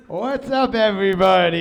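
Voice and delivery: loud, stretched-out hello to a crowd; calling out greeting. Real, not acted. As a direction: holler it to the whole room.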